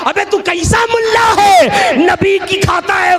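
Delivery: high, loud mock-indignant challenge; scolding shout. Pandit scolding a mulla, high indignant shout. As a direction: tear into him at high pitch.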